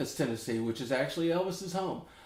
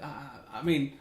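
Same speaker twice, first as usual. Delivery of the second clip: normal talking voice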